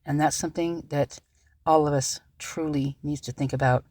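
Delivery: quiet and steadied, holding feelings in; composed again. Real, not acted. Vlogger calmed down, pleading softly with viewers. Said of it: "I just had to compose myself".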